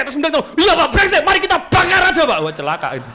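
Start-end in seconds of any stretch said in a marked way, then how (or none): 0.6-2.4 high, loud hammed-up outrage; overdone matched anger. Employee wrongly outdoing the customer's rage, mock angry shout. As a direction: join in his fury and go too far. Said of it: "Nggak boleh dibablasin".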